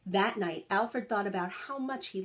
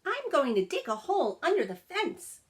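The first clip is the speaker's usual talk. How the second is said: much higher, louder puppy voice; eager young dog; 8 semitones higher, 4 dB louder than usual. A narrator's reading. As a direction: chirp it out like a friendly little pup. Rex announces a plan.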